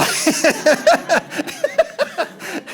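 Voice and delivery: high, bursting laugh. Real, not acted. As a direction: lets out a real laugh. Funny moment with Freeman.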